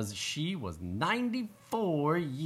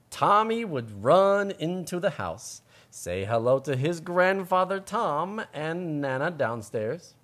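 slow, lively story reading; storybook reading; 4 semitones higher, 5 dB louder, slower than usual. A narrator's reading. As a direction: read it slowly to children. Reading story aloud to kids.

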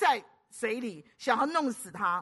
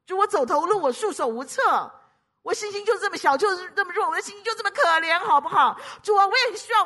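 high, loud, helpless appeal to God; exasperated, no-holds-barred prayer; desperate pleading; 7 semitones higher, 7 dB louder than usual. Acted. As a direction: pour out weakness at the top of your voice. Acts out desperate, blunt prayer.

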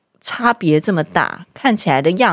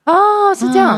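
surprised; 12 semitones higher, 4 dB louder, slower than usual. Real, not acted. Reacts to stress fact.

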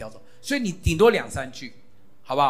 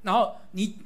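ordinary talk, nothing marked

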